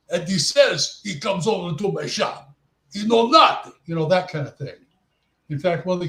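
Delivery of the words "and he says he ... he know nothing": hammed-up Russian accent; thick Russian accent. Shown as a demonstration. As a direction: demonstrate a broken-English Russian character. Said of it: "I start talking like this".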